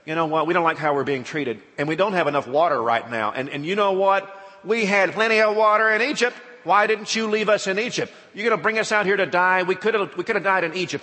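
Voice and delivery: loud, high, whining protest; hammed-up angry grumbling; complaining crowd. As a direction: Israelites complaining to Moses about water, loud and whiny. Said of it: "come and they say".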